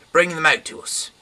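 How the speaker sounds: loud, high mob shout; angry crowd yelling a demand. Acted. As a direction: bellow it at the door.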